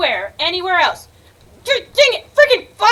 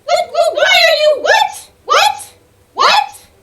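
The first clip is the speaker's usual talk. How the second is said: much higher, rising what of disbelief; baffled surprise; 9 semitones higher, slower than usual. Real, not acted. Gamer stunned by a sudden turn in the game, high baffled whats. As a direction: squeak out each what in confusion.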